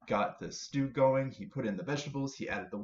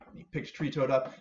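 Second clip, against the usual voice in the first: usual speaking voice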